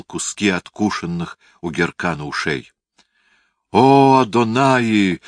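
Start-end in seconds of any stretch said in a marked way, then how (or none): 3.7-5.2 high, loud, drawn-out cry; exclamation. A narrator's reading. Phasael cries to God at the mutilation, exclaiming. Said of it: "воскликнул Фазаэль".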